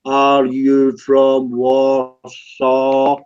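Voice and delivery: each word dragged out extremely long; exaggeratedly slow. Real, not acted. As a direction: repeat it as if to someone hard of hearing, very slowly. Repeats slowly for someone not hearing.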